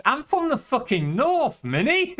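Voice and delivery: high-pitched mock protest; mock outraged northerner. Real, not acted. Defending her northern roots to a friend, high mock protest. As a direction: squeal it in fake outrage. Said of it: "you think I could do a pox?".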